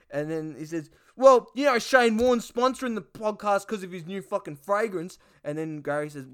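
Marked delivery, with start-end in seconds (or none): 1.2-5.2 high, loud start, mock brash; Duck's voice. Duck plugging the sponsor, high cheeky voice. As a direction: pitch it up like a cheeky bloke.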